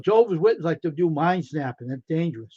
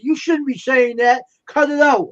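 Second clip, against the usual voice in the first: raised, higher voice, slow and stern; retold scolding; 7 semitones higher, 6 dB louder than usual. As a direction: mimic the partner telling him off, louder.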